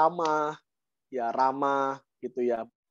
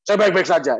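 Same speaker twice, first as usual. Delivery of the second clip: loud, raised choleric reply; brusque choleric; 5 semitones higher, 8 dB louder than usual. Acted. Choleric partner answers phlegmatic mentor's greeting, brusque choleric. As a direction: imitate a forceful choleric answering back. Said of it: "Ketemu orang koleris".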